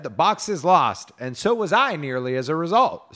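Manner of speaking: high, loud dramatic report; storybook maiden. A narrator's reading. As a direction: announce the loss theatrically to your lady. Maiden lying to her lady.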